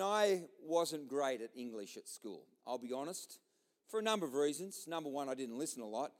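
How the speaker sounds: normal talking voice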